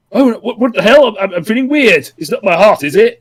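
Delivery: hammed-up alarmed villain; mock panic. Retelling Chog feeling weird.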